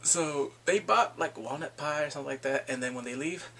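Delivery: usual speaking voice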